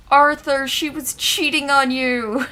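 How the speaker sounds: loud, high outraged call. Real, not acted. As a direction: yell the news at him. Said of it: "calling out to the character".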